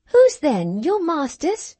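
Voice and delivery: put-on high, girlish question; a spoilt young miss asking; young girl's voice. A narrator's reading. Cathy questions the servant, high girlish voice. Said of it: "she asked".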